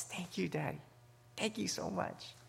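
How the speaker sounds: put-on hushed, tender thank you from a small boy; quiet heartfelt child's thanks; soft grateful child. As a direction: breathe it out like a thrilled little son. Grateful child hugging his father.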